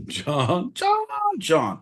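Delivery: high rising then falling John; calling John in hammed-up frustration; mock exasperated. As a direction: wail his name in mock despair.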